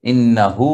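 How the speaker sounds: ইন্নাহু with the হু stretched out long; drawn-out vowel. Shown as a demonstration. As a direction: pull out the ending of ইন্নাহু. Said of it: "দেখবেন যে এটা একটু উচ্চারণটা ... টান দেয়". (ইন্নাহু টেনে উচ্চারণ)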